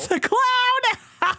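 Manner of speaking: high, laughing cry of delight; gleeful shriek at the clown; excited squeal. Retold or imitated. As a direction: squeal it out with glee. Excited recall of the clown scene.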